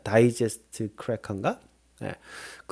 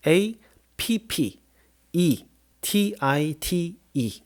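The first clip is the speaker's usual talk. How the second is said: slow letter-by-letter spelling; 3 semitones higher, slower than usual. Shown as a demonstration. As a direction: spell it out slowly, one letter at a time. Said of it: "철자는".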